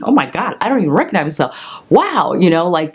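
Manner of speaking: ordinary delivery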